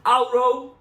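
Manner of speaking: high, loud shouted command. A narrator's reading. Zoe orders Munchkin over the storm, loud shout. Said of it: "shouted Zoe".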